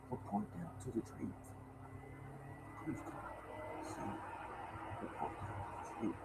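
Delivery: slow, breathy vampire hush; smooth Dracula voice. Retold or imitated. Vice president sending the mailman downstairs, in a slow smooth Dracula hush. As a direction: draw it out smooth and low like a count. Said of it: "He talked real smooth".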